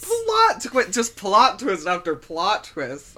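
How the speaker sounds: loud, high burst, then slowing; hammed-up thrilled reaction; mock excitement. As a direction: hype up each new twist. Plays up excitement at plot.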